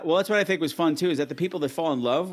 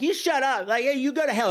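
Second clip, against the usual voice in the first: mock retort; 7 semitones higher than usual. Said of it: "be like".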